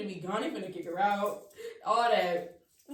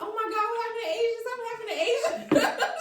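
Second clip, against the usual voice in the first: high-pitched panicked questions; alarmed reaction; 11 semitones higher, 6 dB louder than usual. Retold or imitated. Teller replays asking family what happened to Asia, alarmed. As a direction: blurt it out high, in mock alarm.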